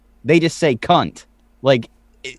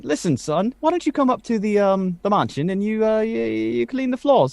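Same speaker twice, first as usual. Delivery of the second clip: lightly voiced employer, pitched up; mansion employer; 6 semitones higher, 3 dB quieter than usual. Employer offers street urchin work, lightly acted master voice. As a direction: play a hint of the mansion's master. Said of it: "you worked for the royal family".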